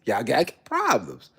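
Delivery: ordinary delivery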